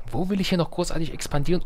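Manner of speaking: usual speaking voice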